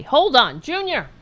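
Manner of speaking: sharp, high-pitched call to a pet. Real, not acted. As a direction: call out to the noisy cat, voice rising. Calling out to pet interrupting the show.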